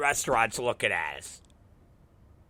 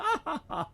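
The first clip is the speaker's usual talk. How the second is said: short high, soft laugh; chuckle; 13 semitones higher, 9 dB quieter than usual. Real, not acted. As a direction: laugh quietly at your own story. Laughs at own joke.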